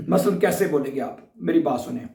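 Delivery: usual speaking voice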